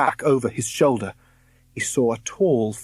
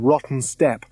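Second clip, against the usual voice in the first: usual speaking voice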